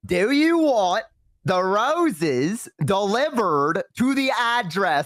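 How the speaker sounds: slow, high, word-by-word delivery; exaggeratedly slow clerk. Annoyed clerk repeating for caller, slow mock patience. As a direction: spell it out as for someone not understanding.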